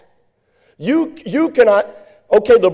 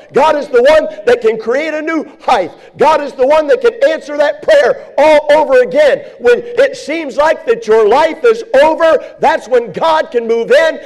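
fiery preaching; 4 semitones higher than usual. Acted. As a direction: preach it full force, building to shouts. Preaching builds to shouting.

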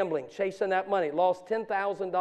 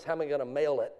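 ordinary talk, nothing marked

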